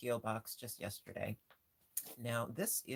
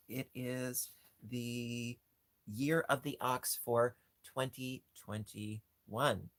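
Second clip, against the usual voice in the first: ordinary delivery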